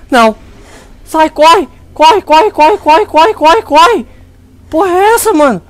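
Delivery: high, loud frantic yells; panicked yelling. Real, not acted. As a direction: yell at it to run, frantic. Still fleeing the monster.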